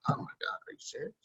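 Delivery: fast, breathless whispered disbelief; exasperated whisper. Retold or imitated. Dad whispering his disbelief at his daughter's drama. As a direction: hiss it under your breath, fast. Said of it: "I'm like".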